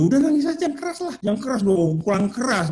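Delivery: high acted goading; mock cheering-on of a crier. Voicing himself goading crying child.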